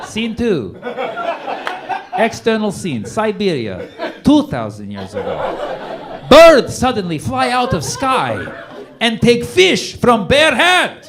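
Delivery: loud, slow broken-English Russian narration; mock Putin screenplay. Comic mocks Putin's history lecture, Russian-accented narration. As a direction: read it like a pompous Russian screenplay. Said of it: "He's like".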